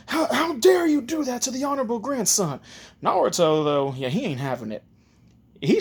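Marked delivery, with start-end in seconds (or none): 0.1-2.6 high-pitched, stammering outrage; flipping out. Acted. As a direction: scold him, scandalised and high. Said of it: "Ebisu's flipping out".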